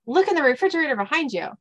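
quick, high little mouse voice. Acted. First mouse directing Dean, high and quick. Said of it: "the first mouse says".

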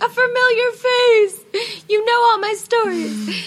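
high, loud and slow, overjoyed; thrilled reunion. Retold or imitated. Traveller meeting a familiar face on the road, gushing with joy. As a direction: gush like you've spotted an old friend abroad.

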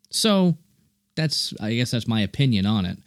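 usual speaking voice

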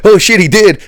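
sudden high shocked cry; shocked oh shit. Retold or imitated. Remembering the crash, high shocked outburst. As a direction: jolt of alarm as it sinks in. Realizing the crash, quoted reaction.

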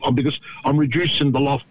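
usual speaking voice

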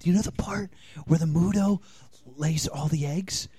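soft, tense whisper; hushed, restrained aside. Real, not acted. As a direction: whisper to hold back the yelling. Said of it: "Just because you're whispering".

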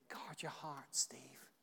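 hushed whisper addressed to oneself; personal whisper. Shown as a demonstration. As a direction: whisper it to yourself. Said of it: "Personalize it sometimes".

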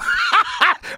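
high-pitched laugh. Real, not acted. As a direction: laugh at your own gag. Laughs at own crumpled-notes gag.

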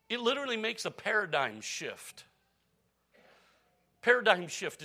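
preacher punching PARADIGM high and loud; emphatic term. Real, not acted. Preacher to church, stressing paradigm shift loudly. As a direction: lean hard on paradigm shift. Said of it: "it's a fifty dollar word".

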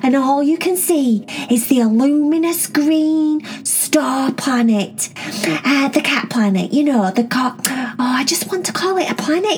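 put-on excited kid asking her sisters questions; Cherry voice.

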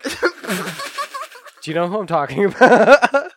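slow, laughing question. Real, not acted. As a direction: laugh through the words. Laughing while asking.

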